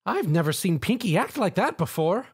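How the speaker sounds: high, concerned murmur; worried motherly baker. Acted. Missus Cake worries after Pinkie, high. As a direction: fret after her.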